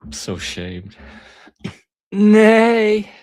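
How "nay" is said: pterodactyl call. Acted.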